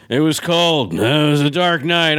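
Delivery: mock noir narrator, sullen delivery; put-on hardboiled voiceover; angry film voiceover. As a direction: imitate a grudging detective narration. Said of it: "sounds like he's angry".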